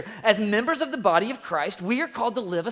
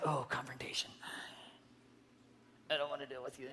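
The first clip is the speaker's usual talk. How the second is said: timid, breathy whisper, much softer and lower; spineless whisper; 10 semitones lower, 19 dB quieter, faster than usual. Retold or imitated. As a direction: shrink away from it in a scared whisper. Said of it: "you're just the spineless, you have no guts".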